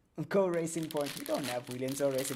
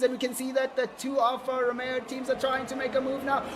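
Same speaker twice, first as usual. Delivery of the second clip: higher, louder, excited play-by-play; live race commentary; 10 semitones higher, 12 dB louder than usual. Acted. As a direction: call the start with rising hype. Calling the race start.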